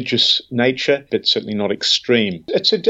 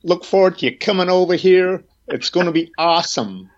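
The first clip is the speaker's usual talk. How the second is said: American accent; 7 semitones higher than usual. Shown as a demonstration. Australian guest greets Peter on request, exaggerated American accent. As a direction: whoop it up in a hammed-up American accent. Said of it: "some American accent".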